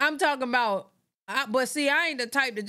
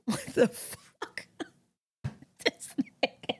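words squeezed out through laughing; real helpless laughter; 4 dB quieter, slower than usual. Real, not acted. Reactor cracking up at Ken's behavior, helpless laughter. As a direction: laugh so hard the voice gives out. Laughing at the clip she watches.

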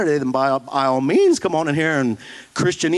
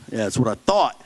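ordinary talk

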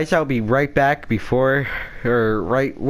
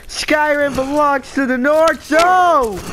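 hammed-up war cry; 13 semitones higher, 4 dB louder than usual.

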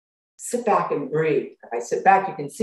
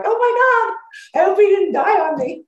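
mock panic; 5 dB louder than usual. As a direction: squeal it like a hammed-up panic. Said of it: "I'm like".

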